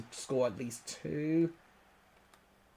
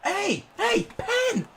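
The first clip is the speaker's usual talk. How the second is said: very high, sharp calls for a foul; appealing shout; 4 dB louder, much slower than usual. Real, not acted. Gamer screaming for a penalty, very high.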